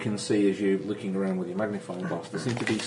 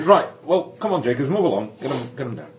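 Yorkshire constable; 4 semitones higher, 6 dB louder than usual. Acted. Constable ordering driver along.

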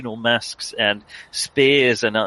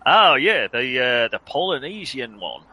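booming Australian professor; 3 semitones higher, 3 dB louder, faster than usual. Acted. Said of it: "very deep, booming voice".

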